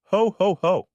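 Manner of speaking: booming high Santa laugh; loud ho ho ho. Acted. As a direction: belt it out for the room. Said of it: "I said loudly".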